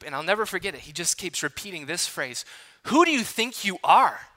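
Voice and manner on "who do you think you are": a mild mock of an angry youth leader's scolding; retold angry scolding. Youth leader scolding a late teen, mildly mock-angry. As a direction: scold him the way an upset leader would, half-acted. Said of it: "He is so angry".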